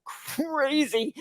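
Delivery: slow, soft, amazed "crazy"; drawn-out exclamation. Retold or imitated. Remarking on hometown accents, drawn-out amazement. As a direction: stretch out "crazy" slowly.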